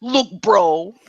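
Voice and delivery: drawn-out New York bro impression; Vince Russo. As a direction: stretch out the bro like a New Yorker. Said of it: "Have you not heard him talk?".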